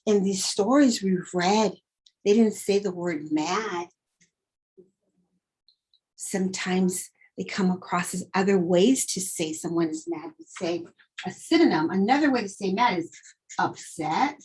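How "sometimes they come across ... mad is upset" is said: normal talking voice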